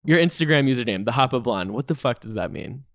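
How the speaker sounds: normal talking voice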